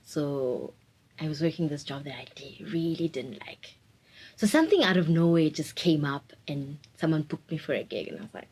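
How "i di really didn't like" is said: breathy whisper, much softer; whispered confession. Real, not acted. Whispering about the job she didn't like. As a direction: confess it under your breath.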